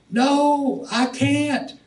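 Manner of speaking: high strained cry of pain; mock anguished no. Victim on the rack cries out.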